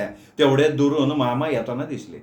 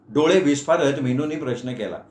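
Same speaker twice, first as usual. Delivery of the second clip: ordinary delivery, nothing marked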